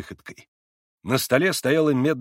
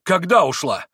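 high, loud demanding cry; urgent shout; 6 semitones higher, 7 dB louder than usual. A narrator's reading. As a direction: demand an answer at once. Mitya demanding.

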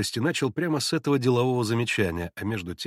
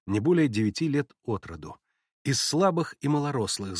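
ordinary delivery, nothing marked

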